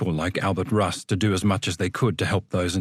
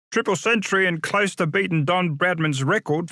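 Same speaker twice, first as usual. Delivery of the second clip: character voice; 8 semitones higher than usual. Acted. Other inmate voiced.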